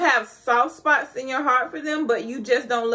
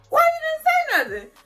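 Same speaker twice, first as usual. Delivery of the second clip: high mock exclaim; 3 dB louder than usual. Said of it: "he was like".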